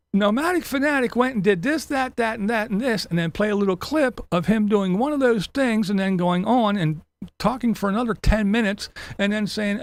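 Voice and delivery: usual speaking voice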